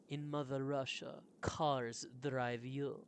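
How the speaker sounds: put-on slow joke in a Russian accent. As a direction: deliver the punchline with a Russian accent. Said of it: "Is that your Russian accent".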